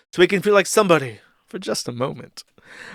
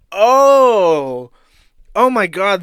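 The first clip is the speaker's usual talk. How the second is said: sudden recognition; 5 semitones higher, 8 dB louder, much slower than usual. Real, not acted. Recognizing the guy.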